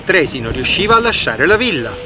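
ordinary delivery

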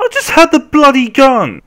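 high, very loud yell; frustrated outburst. Real, not acted. Player who just had the gun, yelling in frustration. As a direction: explode in exasperation.

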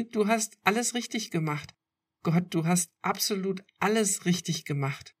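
tearful relief spilling out; laughing through tears. A narrator's reading. Girlfriend reassuring him through her tears, laughing. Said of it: "Sie lachte unter Tränen".

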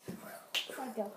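hushed whisper. Acted. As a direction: whisper it to the next person. Whisper challenge, whispering the phrase.

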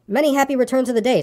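high, eager little voice; high, hopeful well-wishing; Piglet. A narrator's reading. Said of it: "said Piglet again".

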